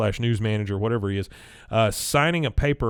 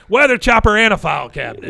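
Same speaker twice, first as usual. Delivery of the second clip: high, loud delighted-style boast; imitated calling out; 10 semitones higher, 9 dB louder than usual. Dale heard as credits roll, mock exclaiming. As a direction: call it out as if over the credits.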